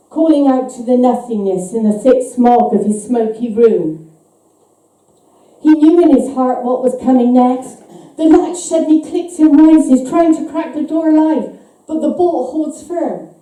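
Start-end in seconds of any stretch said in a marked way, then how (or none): none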